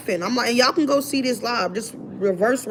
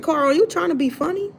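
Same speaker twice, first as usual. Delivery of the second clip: much higher, rising incredulous question; 7 semitones higher than usual. Retold or imitated. Asking Carl about the date, incredulous. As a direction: challenge him with a high rising question.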